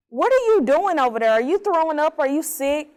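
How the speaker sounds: calling to the next cell through the vent, urgent and alarmed. Retold or imitated. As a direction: shout through the vent to her neighbor, scared for her.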